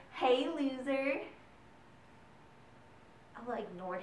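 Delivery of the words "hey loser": slow, drawn-out taunt, louder; lazy schoolyard jeer; taunting sneer. Retold or imitated. Bully Max taunting Ella, slow sneer. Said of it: "threw out the always popular".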